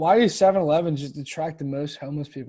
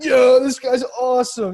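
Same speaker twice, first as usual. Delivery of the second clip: much higher, louder, slow; mock frat bro awe; 9 semitones higher, 8 dB louder, slower than usual. College kids praising a panhandler, loud slow gush. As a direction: gush like a dazzled frat boy. Everyone praising the homeless man.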